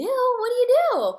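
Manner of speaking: high, loud surprised question. Retold or imitated. Gym friend surprised she's in hair care, asking loudly. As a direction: ask it amazed. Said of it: "She's like".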